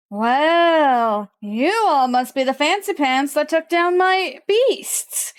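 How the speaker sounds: slow, drawn-out well, then sly menace; taunting villain. Acted. Villain greets those who took down his beasts, taunting. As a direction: draw out the opening like a smug villain. Game master voices the villain.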